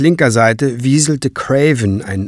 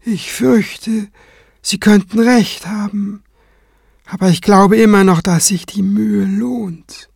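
higher, hesitant voice with careful pauses; concede, then gently insist; composed cleric; 9 semitones higher than usual. Acted. Kranz conceding to Tenebrae but hopeful, composed.

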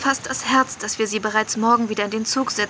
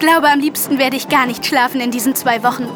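usual speaking voice

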